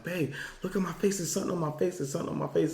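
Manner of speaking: high, insistent reenactment; urgent retold alert. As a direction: urgently get your wife to look, mock-panicked. Waking wife in alarm, retold.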